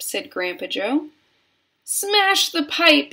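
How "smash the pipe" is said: high, loud, drawn-out yell; frantic mother yelling, high and slow; yelled command. A narrator's reading. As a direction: yell a desperate order. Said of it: "yelled Missus Gloop".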